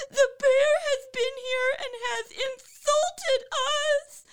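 slow, very high, loud squeal; drawn-out wailing chick complaint; crying, screaming wrens. A narrator's reading. Young wrens tell parents of the insult, screeching. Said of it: "continued to cry and scream".

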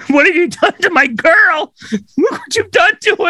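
high hammed-up lament; husband mourning the table; mock grief. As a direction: wail over the broken table. Grieving over table.

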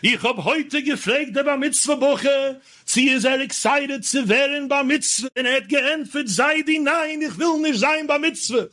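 imitating an old-time rabbi's high Yiddish oration; high, chanting-style Yiddish sermon delivery; grandfather's drasha. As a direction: declaim it high and sustained, like a bar mitzvah speech. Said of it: "incorporated the whole story into his drasha".

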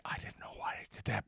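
soft unvoiced whisper. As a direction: whisper it to yourself. Retold thought, whispered.

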